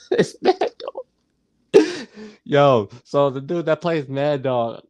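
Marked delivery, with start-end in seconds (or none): 0.1-1.1 very high, put-on spooky squeal; high mock voice. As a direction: repeat it in a much higher voice. Repeats the line in put-on voice.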